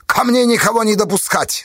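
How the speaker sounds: mildly severe officer; stern order. A narrator's reading. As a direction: give the order with authority. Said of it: "строго велел он писарю".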